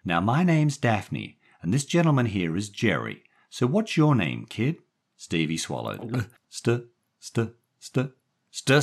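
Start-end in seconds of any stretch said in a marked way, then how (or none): 0.0-4.8 lightly voiced woman, pitched higher; woman character. A narrator's reading. Daphne introducing herself and Jerry to the boy, lightly voiced woman. 6.5-8.8 put-on high, halting little-boy stutter; stuck on the first sound, high-pitched; stammering boy. A narrator's reading. Scared boy trying to tell strangers his name, stammering.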